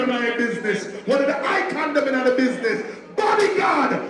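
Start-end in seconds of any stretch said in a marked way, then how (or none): 3.2-4.0 hype shout. Real, not acted. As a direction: call the act up to the stage. MC hyping the next act.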